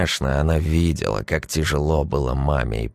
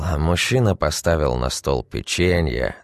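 ordinary delivery, nothing marked

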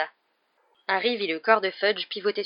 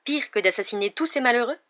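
higher, incredulous curious question; avidly curious, pitched up; excited curiosity; 5 semitones higher, 4 dB louder than usual. A narrator's reading. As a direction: ask eagerly, voice lifting in disbelief. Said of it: "d'un ton excité par la curiosité".

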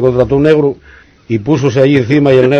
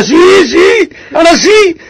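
excited cry; 14 semitones higher, slower than usual. Acted. Wife finally feels something.

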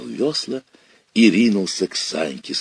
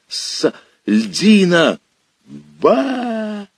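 slow, higher strained wail; howl; 11 semitones higher, 3 dB louder, much slower than usual. Acted. Boy clinging to ice, strained wail. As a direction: cry out in the icy water. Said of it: "разнёсся вопль".

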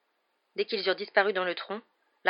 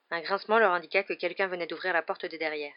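usual speaking voice

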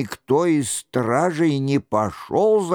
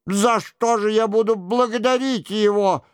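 high-pitched protesting question; lightly voiced monk, pitched high; bewildered monk; 6 semitones higher than usual. A narrator's reading.

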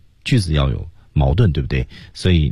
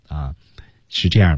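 ordinary talk, nothing marked

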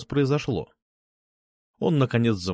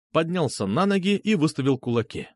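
ordinary delivery, nothing marked

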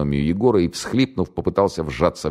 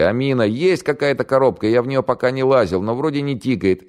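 somewhat higher soldier calling back; lightly voiced sapper; 9 semitones higher than usual. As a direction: report from the dark. Голос сапёра издалека. (слегка озвученный сапёр)

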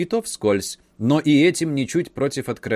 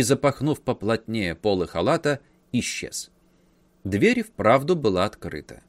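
usual speaking voice

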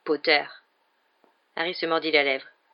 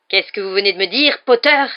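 louder, rising, threatening; outraged Snape; 4 semitones higher, 10 dB louder than usual. A narrator's reading. Snape, outraged, challenges Harry with a loud threatening question. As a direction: dare him to repeat it.

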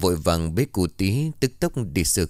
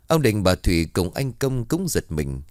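ordinary delivery, nothing marked

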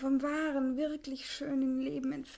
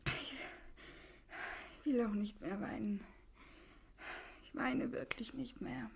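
quieted after tears; 3 semitones lower, 8 dB quieter, slower than usual. Acted. Quiet slow after crying.

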